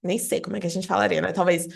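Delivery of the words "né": ordinary talk, nothing marked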